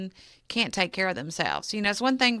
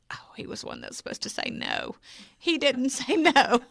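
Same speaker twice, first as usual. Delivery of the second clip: laughing disbelief; 7 semitones higher than usual. Retold or imitated. Her reaction to Ron agreeing.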